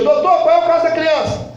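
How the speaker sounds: pergunta aflita ao médico, bem alta; chamado alto. Retold or imitated. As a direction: implora resposta ao doutor. Pai implora ao médico. (pergunta alta e aflita)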